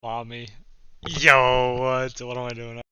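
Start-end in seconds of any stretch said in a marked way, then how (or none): 1.1-2.2 sudden loud burst of disbelief; shocked yell.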